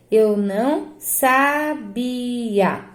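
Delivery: very slow "sabia" with long BI; demonstrated pronunciation. Shown as a demonstration. Teacher repeating it for pupils to hear, slow and drawn out. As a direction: draw out sa-BIII-a in "sabia". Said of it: "Olha a minha pronúncia".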